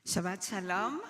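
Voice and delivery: usual speaking voice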